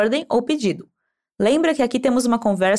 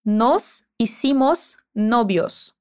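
hicimos novios drawn out slowly, word by word; slow model phrase; 2 semitones lower, much slower than usual. Shown as a demonstration. Teacher slowly modelling the phrase for repetition. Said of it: "Escuta e repete como fica".

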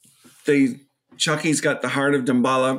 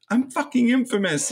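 high-pitched proud boast; mock boast; 10 semitones higher than usual. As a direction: crow about it. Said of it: "He's like".

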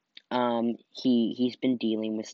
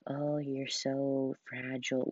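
softer, slow cooing pity; mock pity; 2 semitones higher, 9 dB quieter, slower than usual. Mocking people who treat her as fragile, slow soft coo. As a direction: coo it with fake concern. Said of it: "they treat you like".

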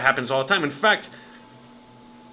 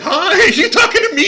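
laughing mock quote; 13 semitones higher, 8 dB louder than usual.

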